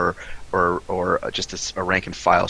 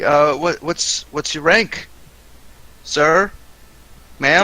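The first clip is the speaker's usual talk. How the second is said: confused soldier; 7 semitones higher, 3 dB louder, slower than usual. Acted. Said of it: "and say".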